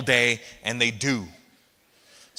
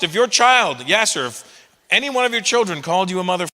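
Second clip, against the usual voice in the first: usual speaking voice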